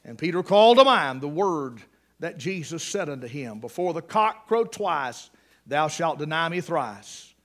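usual speaking voice

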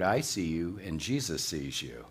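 low, soft warning from the dark; mysterious parrot voice. Retold or imitated. Hidden parrot warning a thief at night, low and soft. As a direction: deliver a quiet, low, lightly acted warning. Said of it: "He hears this word".

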